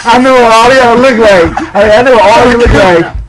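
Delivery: loud, high mock threat; mock threatening shout. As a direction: shout it at them as a comic warning. Mock threat to laughing friends.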